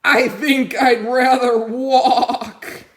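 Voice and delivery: loud, slow and doubtful; reluctant horse. Acted. Horse on the tractor, wishing he had walked. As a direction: drag it out, unconvinced.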